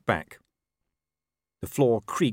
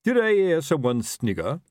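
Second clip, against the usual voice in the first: higher, suspicious officer's demand; raised, accusing question; 7 semitones higher, 4 dB louder than usual. A narrator's reading. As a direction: demand it like an affronted superior. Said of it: "he demanded".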